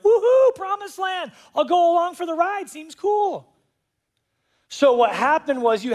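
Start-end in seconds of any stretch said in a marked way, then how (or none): 0.0-3.5 whooping, much higher and louder; a cheerful hanger-on yelling along; carefree tagalong. Retold or imitated. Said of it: "that were like".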